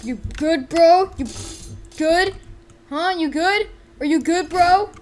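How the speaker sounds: escalating urgent check; insistent, building. Pretend. Repeated check grows more frantic.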